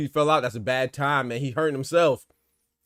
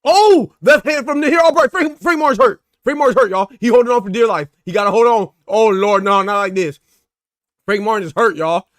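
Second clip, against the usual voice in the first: loud, high, urgent calls as the fighter wobbles; excited shouting; 7 semitones higher, 11 dB louder than usual. Real, not acted. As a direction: yell it out, can't believe what you're seeing. Live fight, fighter badly hurt.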